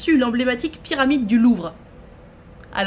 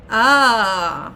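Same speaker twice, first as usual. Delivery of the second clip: normal talking voice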